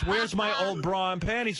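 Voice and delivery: high, indignant imitation; mock dismay. Woman demands her old clothes back, mock dismay.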